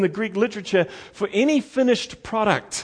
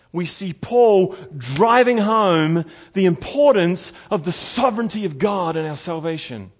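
usual speaking voice

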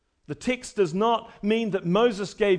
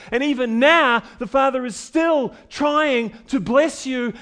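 louder, higher sermon voice pressing Jesus's point home; full-force preaching; 6 semitones higher, 8 dB louder than usual. Acted. Preacher pressing Jesus's words on the congregation, full-force preaching. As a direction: drive it at the crowd like a rebuke.

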